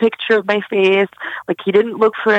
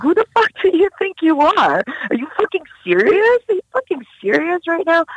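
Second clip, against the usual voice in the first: high mocking sneer, pitched well up; retelling the attacker's taunts in a high mock sneer; sneering abuser; 10 semitones higher than usual. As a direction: mimic him sneering and jeering at you. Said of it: "sneering at me".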